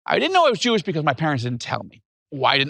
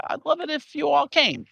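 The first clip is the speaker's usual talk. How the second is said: lightly voiced dad; 12 semitones higher than usual. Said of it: "he says over lunch".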